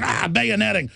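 ordinary delivery

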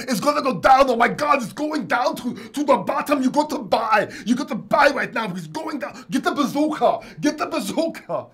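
frantic, high, loud crypto-hype parody. Retold or imitated. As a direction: shout it like a panicking market streamer. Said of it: "the way he talks like this".

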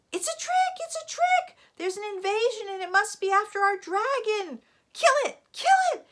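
very high, loud animal mob outcry; furious yelling. A narrator's reading. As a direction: yell like an enraged mob of jungle animals. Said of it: "all yelling".

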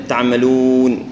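تعملون slowly, lips rounded neatly on the waw; nicely rounded waw. Shown as a demonstration. Teacher shows a neat rounded waw in تعملون. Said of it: "ضمها بشكل جميل".

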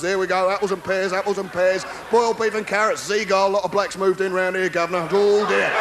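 lightly voiced Cockney cab driver; a hint of Cockney, shouted up at a balcony; cheery Cockney geezer voice, lightly done; Cockney cabbie. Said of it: "lovable Cockney cab drivers".

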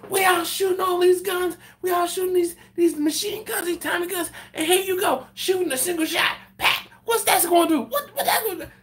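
high, loud exasperated rant; hammed-up Arsenio yelling; mock yelling. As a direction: yell at him in disbelief, cracking into laughter. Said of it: "he starts yelling at the dude".

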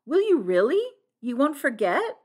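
high, loud, eager questions; eager cry. A narrator's reading. Flora making sure Blunt will remember, crying out eagerly. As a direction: press him eagerly, voice lifted. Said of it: "cried Flora".

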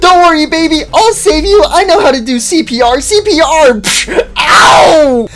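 high, loud frantic yelling; hysterical panic. Acted. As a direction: shriek as if the baby is dying. Hysterical fear for the baby.

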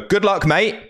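high, pointedly cheery good luck. Retold or imitated. Dealer needles his rival, pointed and high. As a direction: rub it in with fake friendliness. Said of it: "I make a point of it".

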